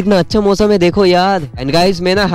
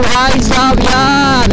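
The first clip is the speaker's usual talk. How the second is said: slow drawn-out भाई साहब यार ending on a falling यार; catchphrase, falling; 5 semitones higher, much slower than usual. Shown as a demonstration. Vlogger doing his famous line for old subscribers, slow and falling. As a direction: savour each word, let यार drop. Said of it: "मेरा ये dialogue ना भाई बहुत ज़्यादा famous हुआ था".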